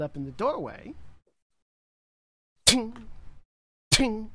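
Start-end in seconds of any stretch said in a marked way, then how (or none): 2.7-4.3 metallic ting sound effect. Acted. GM voicing metal bones clinking.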